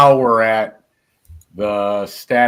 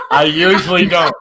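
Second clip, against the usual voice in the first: higher, amused, laugh in the voice; 5 semitones higher, 4 dB louder than usual. Real, not acted. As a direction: say it while still chuckling. Repeats joke while laughing.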